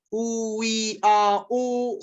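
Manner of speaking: "oui, a, o" held vowel after vowel, oo-ee-ah-oh; four turning vowels. Shown as a demonstration. Teacher counting four syllables, "oui, a, o" drawn out. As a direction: stretch each of the four vowels in "oui, a, o" on a steady note. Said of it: "c'est quatre".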